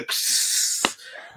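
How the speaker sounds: long voiceless hissing drag noise; imitated drag. Homie hauling on the shared joint, imitated hiss. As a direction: mimic a huge pull on a joint. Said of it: "Here comes homie with the".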